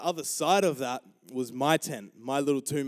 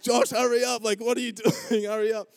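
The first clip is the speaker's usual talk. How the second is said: loud, high, hurrying shout; urgent retold yell; 8 semitones higher, 6 dB louder than usual. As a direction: yell at a dawdling brother, imitated. Retold yelling at running brother.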